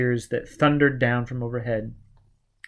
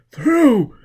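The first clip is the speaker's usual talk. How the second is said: much higher, louder burst; triumphant cry; 12 semitones higher, 8 dB louder than usual. A narrator's reading. Foyle breaking through the wall, triumphant. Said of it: "Foyle muttered".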